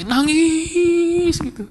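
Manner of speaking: "nangis" soaring high and held long; high, drawn-out crying word; imitated wail.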